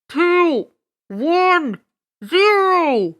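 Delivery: loud countdown over a PA; booming loudspeaker. Acted. Loudspeaker counting down to the broadcast, booming announcer voice. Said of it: "A loudspeaker voice boomed".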